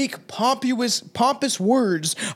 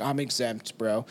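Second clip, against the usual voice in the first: low deadpan; 8 semitones lower, 7 dB quieter than usual. Pretend. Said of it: "be like".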